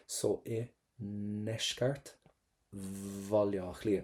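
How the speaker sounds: "So in Neshkart Valja Clia" stretched very slowly; slow each syllable of "Valja Clia" right down; slow drill. Shown as a demonstration. Teacher slowing "So in Neshkart Valja Clia" for students to copy. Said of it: "pop the letter V".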